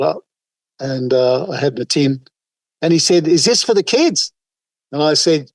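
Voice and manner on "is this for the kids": higher-pitched voice for the bloke at the car; lightly voiced curious bloke; bloke asking. A driver asking the collector, in a light higher voice. Bloke asks about charity tin.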